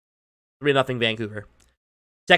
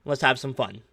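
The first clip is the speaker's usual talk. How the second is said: upbeat, pitched-up sponsor pitch; sponsor ad read; 3 semitones higher than usual. Acted. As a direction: sell the league like a friendly ad.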